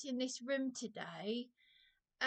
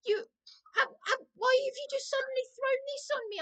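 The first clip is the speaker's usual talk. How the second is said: raised, heated reenactment of shouting at the manager; indignant mock shout, higher pitched; retold yelling; 9 semitones higher, 4 dB louder than usual. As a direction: replay the angry yell, higher and louder. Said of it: "I started yelling down the phone".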